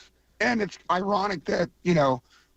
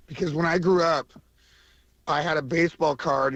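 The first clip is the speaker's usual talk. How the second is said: ordinary delivery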